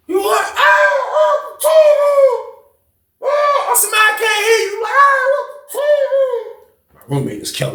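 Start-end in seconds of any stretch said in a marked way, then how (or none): 0.1-4.9 high, drawn-out mock holler. Roommate mocking the caller with a stretched-out shout. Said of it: "He like". 4.9-6.7 mock call.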